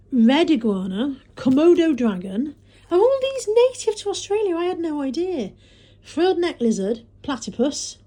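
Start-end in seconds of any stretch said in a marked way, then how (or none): 2.9-5.5 surprised. Real, not acted. Reading Moira's tea towel to viewers, genuinely surprised. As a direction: pitch leaps high in amazement. Amazed at Australian animals list.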